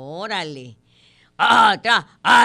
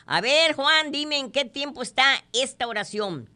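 lightly voiced schoolteacher, pitched up; teacher questioning; 7 semitones higher than usual. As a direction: quiz a pupil in a higher voice. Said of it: "La maestra le pregunta a Juan". (voz ligera de maestra preguntando, aguda)

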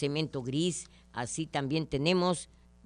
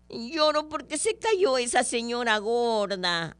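put-on higher little-boy voice, whimpering; whiny child; 5 semitones higher than usual. Little boy explaining to his mother why he cries, whiny. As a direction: play the tearful little boy.

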